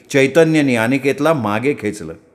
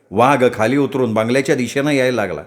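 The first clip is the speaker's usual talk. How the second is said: plain reading voice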